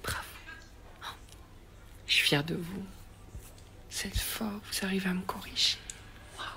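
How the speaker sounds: sarcastic hushed whisper; breathy sarcastic whisper of fake admiration; mock whisper. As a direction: whisper the praise, mocking. Mock praise of spelling police.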